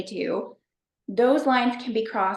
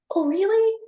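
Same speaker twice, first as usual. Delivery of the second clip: mock surprise; 11 semitones higher, 4 dB louder than usual. Retold reply to friend.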